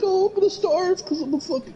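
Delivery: very high, helpless put-on voice; squeaky mocking falsetto; mock pampered whiner. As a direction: mimic a spoiled kid giving up. Mocking pampered rich kids.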